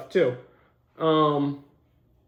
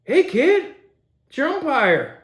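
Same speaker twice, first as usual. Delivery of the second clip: high, loud hammed-up call; mock umpire; 7 semitones higher, 4 dB louder, slower than usual. Pretend umpire hailing a Little League kid, hammed-up.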